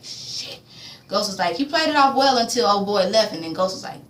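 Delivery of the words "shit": a voiceless whispered curse; whispered shit. Retold or imitated. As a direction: whisper it as the truth clicks. Ghost realizes Lobos alive.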